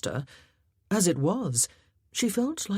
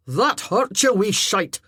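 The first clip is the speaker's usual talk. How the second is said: louder, indignant swearing; pained, cursing outburst; angry yelp; 5 semitones higher, 7 dB louder than usual. Acted. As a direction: snap at the rock thrower. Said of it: "swore loudly".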